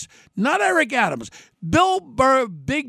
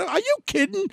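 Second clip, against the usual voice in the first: incredulous outburst; 11 semitones higher than usual. Retold or imitated. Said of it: "I went crazy".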